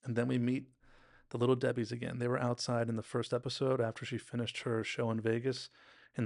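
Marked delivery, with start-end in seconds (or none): none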